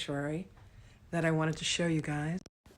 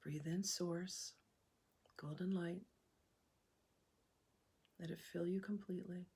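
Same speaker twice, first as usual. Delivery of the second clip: soft, unhurried meditation voice with long pauses; hushed, calm breathwork leader; guided meditation; 7 dB quieter than usual. Acted. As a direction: guide them gently through each breath, leaving room to breathe.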